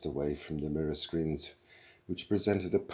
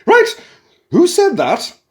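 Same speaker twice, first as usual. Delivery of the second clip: much higher, much louder, sharp snap; snapping matron; 19 dB louder than usual. A narrator's reading. Computer demanding who joked, loud high snap. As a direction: snap like an affronted nanny. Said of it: "snapped the computer".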